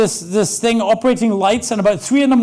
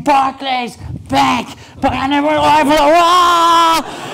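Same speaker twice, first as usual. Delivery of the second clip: rising into a mock stage shout; mock rock-star CEO cry to a conference crowd; hyped CEO yell; 4 semitones higher, much slower than usual. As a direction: pump up the crowd like a hammed-up CEO.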